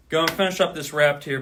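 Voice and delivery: loud, breathless push; gasping after a long count; out of breath. Real, not acted. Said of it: "I'm fucking out of breath now".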